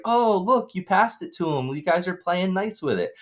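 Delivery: high, sing-song fake cheer; sugary grandma. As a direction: gush like a doting grandma. Grandmother quoted sweetly.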